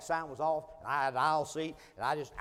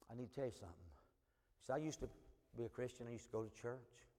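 hushed and much softer; confiding quietly; 5 semitones lower, 15 dB quieter than usual. Retold or imitated. Stranger confessing his past to the preacher, quiet and confiding. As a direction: confess it low and personal. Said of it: "his tone began to change".

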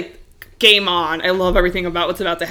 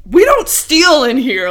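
louder, slower, firm retail scold; stern warning; 6 semitones higher, 6 dB louder than usual. Retold or imitated. Clerk confronting a shoplifter, stern and firm. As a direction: lay down the law to the thief. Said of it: "I just went pure Genevieve Wild".

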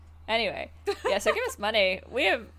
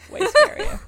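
way higher, louder squeal of mock fear; squeaky joke; 14 semitones higher, 10 dB louder, slower than usual. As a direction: squeal it like you're scared. Punchline about tarantula, squeaky.

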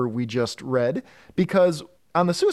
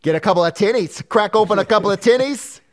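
mock Australian accent; 5 semitones higher, 5 dB louder than usual. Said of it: "my Australian accent".

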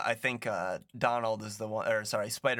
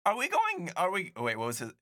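impatient Riddler voice; 8 semitones higher than usual. Retold or imitated. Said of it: "He's like".

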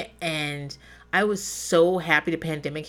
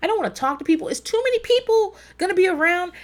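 whiny dread; 9 semitones higher than usual. Retold or imitated. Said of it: "I go".

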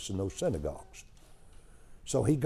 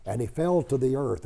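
ordinary delivery

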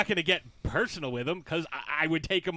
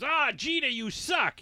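higher, jeering fan call; mock heckle; 8 semitones higher than usual. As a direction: heckle Jeter from the couch. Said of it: "watch Yankees games".

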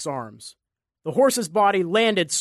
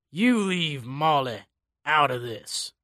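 growling; 2 semitones lower, slower than usual. Acted. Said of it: "he growled".

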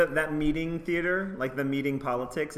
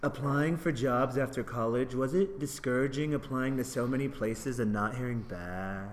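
slow, lower, softer mopey emo voice; 4 semitones lower, 4 dB quieter, slower than usual. Pretend. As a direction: drag it out like a sulky emo teen. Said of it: "like, an emo voice".

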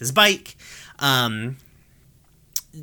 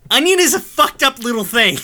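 high, loud, through giggles; laughing; 11 semitones higher, 5 dB louder than usual. Real, not acted. Host cracking up about Onion, laughing high. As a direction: laugh out the disgust.

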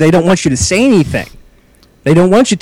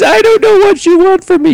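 mimicking waitress crying; 4 dB louder than usual. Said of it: "she's just bawling now".

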